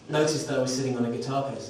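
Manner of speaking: ordinary delivery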